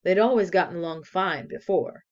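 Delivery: ordinary delivery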